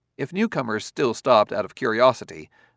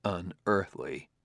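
quiet thought; 3 semitones lower, 9 dB quieter, slower than usual. A narrator's reading. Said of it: "thought Bailey".

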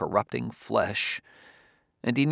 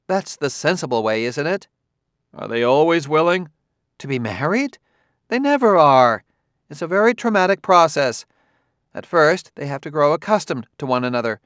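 lightly raised, fussy woman's voice; a lightly voiced fretful caretaker; mildly exasperated Clarissa; 5 semitones higher, 5 dB louder than usual. A narrator's reading. Clarissa explains.

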